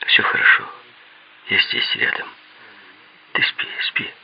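hushed, soothing near-whisper; tender. A narrator's reading. Comforting Mira back to sleep, tender. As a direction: soothe gently, fading into a whisper. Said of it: "ласково сказал я".

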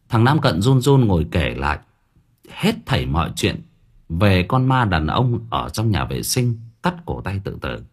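ordinary delivery

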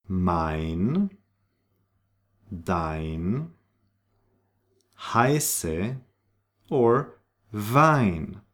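mein, dein, heiße, Wein with an eye vowel; spaced model words, mein as "mine". Shown as a demonstration. Teacher models German EI, long eye sound. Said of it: "is pronounced like I".

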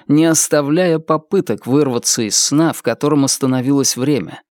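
usual speaking voice

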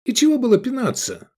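high, loud indignant question; starting to seethe. A narrator's reading. Kicked diner to his friend, seething. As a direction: boil up in protest. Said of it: "закипая".

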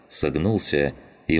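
ordinary delivery

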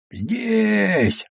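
a long, high drawn-out call; drawn-out answer. Acted. The watchman answers the tsar from afar with a long, high drawn-out call. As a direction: stretch the word out as you answer from afar. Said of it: "ответил протяжный голос".

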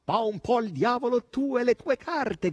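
loud, high, brazen yell; shouted dismissal. A narrator's reading. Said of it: "gridò Natascia". (grido forte e sguaiato)

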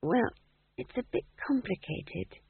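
soft, high, hesitant reply; small, uncertain voice; weakly. A narrator's reading. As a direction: explain feebly, with a pause. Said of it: "said Maigrat weakly".

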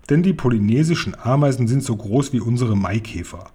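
ordinary delivery

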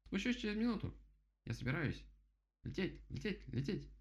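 высокий тараторящий голос птицы; говори высоко, с торопливыми повторами; суетливый дятел. Acted. Голос дятла. (суетливо, голосом дятла)

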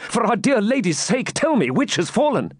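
high, urgent entreaty; pleading cry. A narrator's reading. Knight begs maiden for news, crying out anxiously. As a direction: beg for the news, pitched high. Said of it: "cried Ivanhoe".

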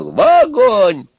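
very high, loud, stretched-out scream; enraged shriek. Acted. Baba Yaga condemns Ivan to the fire, shrieking. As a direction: scream it with all your rage.

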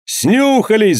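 high accusing cry; crowd shout. A narrator's reading. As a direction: jeer it from the mob. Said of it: "закричали голоса".

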